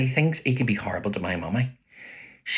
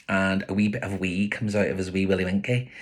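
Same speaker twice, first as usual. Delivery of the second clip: plain reading voice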